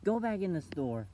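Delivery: imitated telling-off, high and forceful; mock mad mom. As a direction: order the kid off like an angry mom. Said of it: "I'm mad at you".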